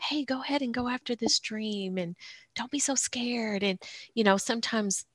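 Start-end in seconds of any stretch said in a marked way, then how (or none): 0.0-3.6 gentle inner voice. Retold or imitated. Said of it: "that little tiny voice in your heart".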